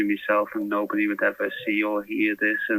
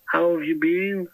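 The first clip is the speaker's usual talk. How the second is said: high mock Scouse question; imitating the guard's put-on Scouse; cheery fake Scouse, pitched up; Scouse accent; 8 semitones higher than usual. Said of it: "only in a Scouse accent".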